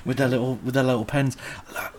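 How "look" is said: whispered announcement of a find; soft, playful whisper; hushed reveal. Pretend. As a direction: reveal it under your breath. Hushed reveal of found document.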